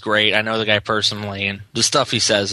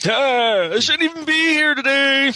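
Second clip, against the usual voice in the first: whiny complaint. Pretend. Whiny joke about being tired.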